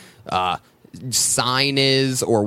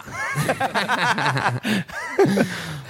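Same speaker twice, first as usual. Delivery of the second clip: laughing; 9 semitones higher than usual. Real, not acted. Laughing at the bit.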